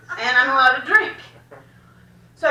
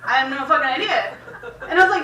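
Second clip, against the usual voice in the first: usual speaking voice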